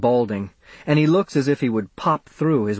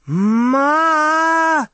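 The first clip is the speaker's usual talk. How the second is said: loud, very high drawn-out wail; frightened child's cry; 6 dB louder than usual. Acted. Boy strapped to the table calls his mother, frightened cry. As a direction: call out for mother in panic.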